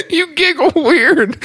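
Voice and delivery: high, squeaky laughing speech; helpless giggling. Real, not acted. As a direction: talk through a real giggling fit. Helpless giggling fit while talking.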